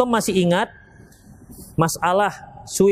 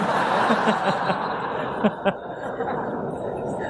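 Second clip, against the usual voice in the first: soft laugh; 5 dB quieter than usual. Real, not acted. Laughing at his own joke.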